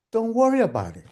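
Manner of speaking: punchline echo. Acted. Son echoing mom's own words, emphatic and high. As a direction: toss the catchphrase back with force.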